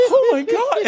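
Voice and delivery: high, loud burst of laughter; laughing. Real, not acted. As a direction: crack up at the memory. Laughing at own story.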